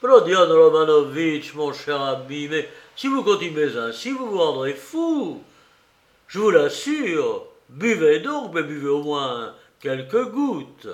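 loud opening, then high, urgent appeals; alarmed pleading. A narrator's reading. Investigator warns the visitor he will go mad, pleading in alarm. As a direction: beg him to calm down and drink.

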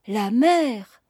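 enthusiastic exclamation. A narrator's reading. Young Noël hears of sea battles, exclaiming enthusiastically. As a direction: exclaim with a touch of eagerness. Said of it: "s'écria Chantepie, dont le jeune visage rayonna d'enthousiasme".

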